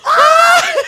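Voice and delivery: high, loud shriek of fright; startled scream. Retold or imitated. Friend reenacting her scream at the window, startled shriek. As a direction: scream like you just saw the truck. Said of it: "just like".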